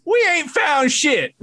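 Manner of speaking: high, slow goofy movie henchman drawl; Spaceballs desert guys. Retold or imitated. Friend jokes about IRS auditors, does a high, slow goofy henchman voice. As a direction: ham it up like a dim-witted desert searcher. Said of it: "those two guys in the desert and Spaceballs".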